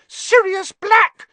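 high piercing shriek of terror. A narrator's reading. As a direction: shriek the name in fright. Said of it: "she shrieked".

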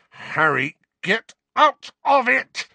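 high, loud angry snarl. A narrator's reading. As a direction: bark it at Harry. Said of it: "snarled Sirius".

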